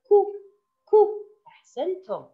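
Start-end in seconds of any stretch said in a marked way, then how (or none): 0.1-1.1 short "كو", a quick rounded "ku"; damma sound. Shown as a demonstration. Teacher shows pupils kaf with damma, short rounded syllable. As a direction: say "كو" briefly with rounded lips. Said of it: "ينطق".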